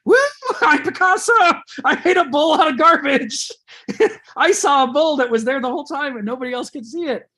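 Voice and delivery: high, loud, laughing boast; gleeful Picasso. Retold or imitated. Picasso boasting to everyone about his junk bull, ecstatic. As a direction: crow with joy like an artist who sees genius in garbage. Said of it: "He goes".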